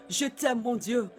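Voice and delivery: closing a prayer in hushed adoration; tender whispered love. Real, not acted. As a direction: murmur your love to God, intimate and hushed. Intimate closing of worship prayer.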